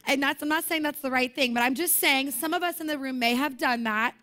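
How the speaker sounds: ordinary talk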